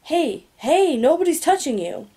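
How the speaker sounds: a touch of startled backing off; alarmed. A narrator's reading. Guard reassuring a screaming child, mildly alarmed. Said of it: "the other says in alarm".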